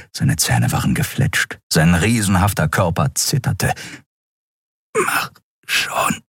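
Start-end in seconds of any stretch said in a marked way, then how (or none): 5.0-6.2 faint, halting whisper; weak, choked urging; barely intelligible. A narrator's reading. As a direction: breathe it out with no voice left. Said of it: "Endriels Worte waren kaum zu verstehen".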